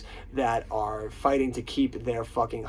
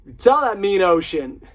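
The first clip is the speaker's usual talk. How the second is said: high, loud celebrity impression; Christopher Walken; 11 semitones higher, 12 dB louder, slower than usual. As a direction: shout encouragement in an imitated movie-star voice. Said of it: "And Christopher Walken's like".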